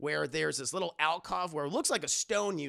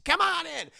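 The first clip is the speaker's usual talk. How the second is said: mock cheery host; 12 semitones higher, 6 dB louder than usual.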